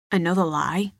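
ordinary delivery

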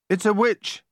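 high-pitched yell of warning; alarmed yell. A narrator's reading. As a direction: call out in alarm, pitched well up. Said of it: "yelled Joel".

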